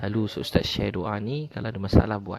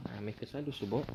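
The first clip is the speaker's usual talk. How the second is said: hushed repeat; 11 dB quieter, faster than usual. Shown as a demonstration.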